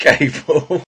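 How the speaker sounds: ordinary delivery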